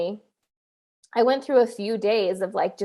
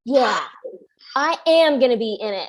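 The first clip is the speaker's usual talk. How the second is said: defiant decision; 4 dB louder than usual. Retold or imitated. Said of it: "I was just like".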